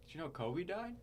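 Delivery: soft, casual imitated question; retold nonchalance. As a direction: ask it offhand and quietly, imitating a casual remark. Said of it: "Just, like, nonchalant".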